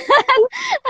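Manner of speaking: short high real laugh; a happy burst of laughter. Real, not acted. Guest laughs about free time.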